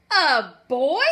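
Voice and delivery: loud, startled queen; shocked. Acted. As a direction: blurt it in royal shock.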